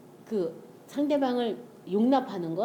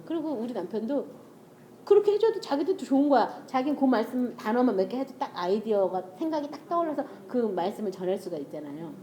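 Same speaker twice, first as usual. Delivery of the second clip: normal talking voice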